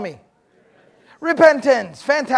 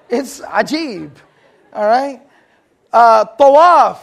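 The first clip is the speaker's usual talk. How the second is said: normal talking voice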